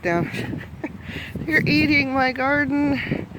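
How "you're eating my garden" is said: slow, higher and louder, mock-scolding the animals. Real, not acted. Farmer chides animals eating her garden, slow mock scolding. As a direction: drag it out in a chiding way.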